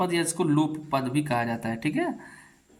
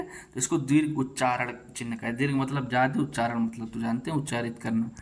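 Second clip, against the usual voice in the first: normal talking voice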